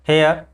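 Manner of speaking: हेयर as he-a without r; silent r. Shown as a demonstration. Teacher confirming for students, हेयर with silent r. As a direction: leave the r off हेयर. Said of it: "क्या बोलेंगे".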